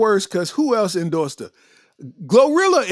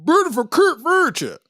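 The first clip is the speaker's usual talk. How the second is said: higher, mocking Memphis accent; 6 semitones higher than usual. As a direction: repeat it mocking her accent. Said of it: "with that Memphis accent".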